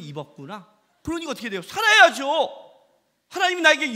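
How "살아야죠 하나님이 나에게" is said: loud, higher preacher's cry; forceful exclamation. Acted. Preacher urging his congregation, loud. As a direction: declare it from the pulpit.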